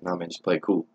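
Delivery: softly telling a friend to stay calm; low and hushed, keep it cool; hushed reassurance. Retold or imitated. Friend quietly calming the narrator near the cop.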